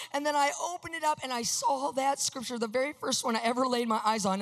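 ordinary talk

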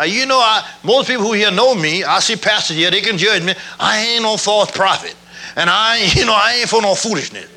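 ordinary talk, nothing marked

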